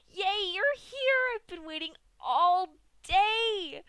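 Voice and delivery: very high, loud, bouncy and drawn out; high excited Pinkie. A narrator's reading. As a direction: squeal it like a pony bouncing with joy. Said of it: "bouncing in excitement".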